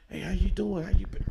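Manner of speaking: high, sing-out hello; cheery retold greeting, voice lifted; imitated friendly greeting. Voices a friendly greeting between mothers.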